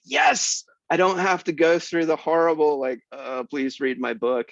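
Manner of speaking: high, eager voice on the yes; mock eager yes. Author mimicking his own eager relief, high pitched. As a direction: play your younger self thrilled. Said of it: "And I was like".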